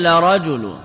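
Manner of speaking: لرجل begun with an "a", "la-rajulun"; fatha on lam. Shown as a demonstration. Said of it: "بالفتح".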